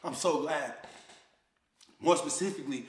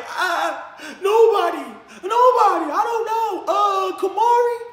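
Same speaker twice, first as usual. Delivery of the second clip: put-on much higher, louder wailing child voice; imitating crying daughter; 13 semitones higher, 8 dB louder, slower than usual. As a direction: whimper it like a scared little girl. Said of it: "My daughter crying".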